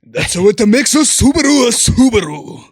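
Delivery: loud, high car commercial voice; Subaru ad voice. Pretend. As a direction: boom it like a car ad. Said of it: "I'm gonna do my Subaru thing".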